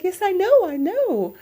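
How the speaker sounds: high, loud weepy agreement; hammed-up tearful cry; mock bawling. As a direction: imitate wailing through happy tears. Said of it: "I'm bawling my eyes out".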